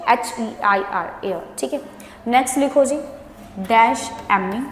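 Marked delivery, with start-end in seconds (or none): 3.6-4.6 slow, word by word dictation. Shown as a demonstration. Teacher dictating blanks slowly to the class. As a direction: spell it out slowly for writing. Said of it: "ਨਾਲ ਨਾਲ ਬੋਲ ਵੀ ਰਹੀ ਹਾਂ".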